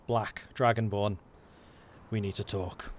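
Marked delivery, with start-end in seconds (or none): 2.1-2.9 low menacing line. Acted. GM voices the dragonborn.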